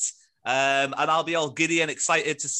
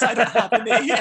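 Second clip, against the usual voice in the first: laughing; 7 semitones higher, 3 dB louder than usual. Real, not acted. Laughing at own joke.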